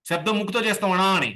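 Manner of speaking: normal talking voice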